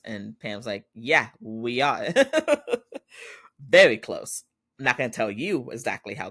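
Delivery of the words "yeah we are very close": drawn-out, teasing mock-coy answer; coy retort. Pam needling the dad about being close, coy. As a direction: stretch it out, relishing the insinuation.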